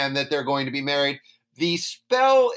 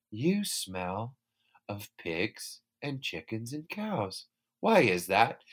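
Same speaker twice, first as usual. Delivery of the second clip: low, much softer prim voice; prim princess; 7 semitones lower, 8 dB quieter, slower than usual. Retold or imitated. Princess asking Jack about his smell, low and quiet. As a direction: ask it low and hushed.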